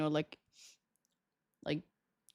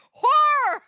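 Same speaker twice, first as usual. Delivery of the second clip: high, loud cry of "Horror!"; shouted horror; 13 semitones higher, 9 dB louder than usual. Shown as a demonstration. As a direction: belt out "Horror!" in mock terror. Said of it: "that sounds bad".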